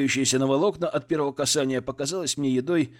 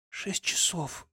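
rough, breathless hoarse whisper; husky and drained, barely voiced; 4 semitones higher, 6 dB quieter, much slower than usual. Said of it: "Голос у неё был хриплым".

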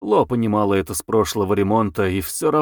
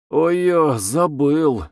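drawn-out, mildly apologetic oops; a touch of sheepish apology; 4 semitones higher, much slower than usual. A narrator's reading. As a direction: own up to forgetting, higher voice. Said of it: "извинительно сказал Дима".

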